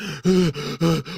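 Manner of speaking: mock gasping for air; hammed-up frightened gasps; panicked gasps. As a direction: gasp as if reeling from shock. Acts the man panicking.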